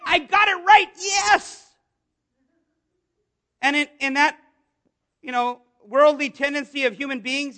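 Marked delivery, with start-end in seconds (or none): 0.0-1.7 pumped, high and loud; high, loud burst of triumph; excited. Acted. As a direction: exult like you just got it right. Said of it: "He is excited".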